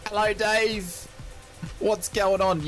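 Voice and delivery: high, drawn-out hello; excited greeting. Real, not acted. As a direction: sing out a slow cheerful hello. Greeting viewer Dave.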